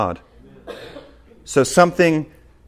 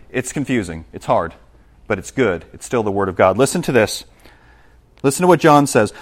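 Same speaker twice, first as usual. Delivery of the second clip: usual speaking voice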